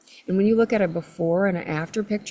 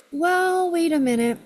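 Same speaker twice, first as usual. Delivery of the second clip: high mock God voice; 10 semitones higher, 3 dB louder than usual. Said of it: "And he goes".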